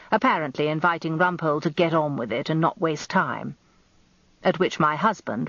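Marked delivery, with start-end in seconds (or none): none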